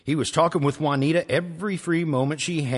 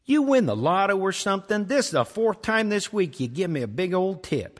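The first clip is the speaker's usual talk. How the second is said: higher, louder chatty waitress; 7 semitones higher, 3 dB louder than usual. Acted. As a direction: tease him about the tip.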